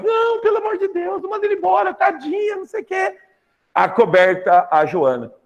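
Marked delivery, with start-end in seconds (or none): 0.0-3.2 high pleading cry, begging not to fire her; desperate pleading. Retold or imitated. Luísa begging her husband to keep the maid, high frantic plea. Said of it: "A Luísa".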